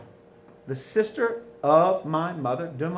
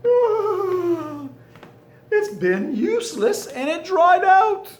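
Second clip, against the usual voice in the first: exasperated outburst; 7 dB louder, slower than usual. Real, not acted. Marker failing, frustrated exclamation.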